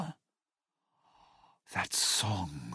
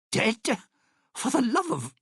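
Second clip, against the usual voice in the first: high-pitched, incredulous outburst; exasperated disbelief; 11 semitones higher than usual. Acted. Exasperated outburst at bad news.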